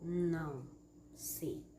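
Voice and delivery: low, halting words with long pauses; hesitant stammer. Shown as a demonstration. Teacher acting out suspense of an unfinished reply, hesitant. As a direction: break it up, SEI dropping very soft. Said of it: "Gaguejou ele".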